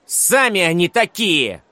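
put-on high, slow, indignant boy's cry; cornered schoolboy's angry outburst; furious shout. A narrator's reading. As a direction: hurl it back at them all. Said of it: "яростно крикнул Редькин".

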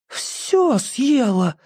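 slow, high, gasping dismay; gasped. Acted. Petka gasps that Nyuska ate all the candy, slowly. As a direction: let it out as a slow gasp of shock. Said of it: "ахнул Петька".

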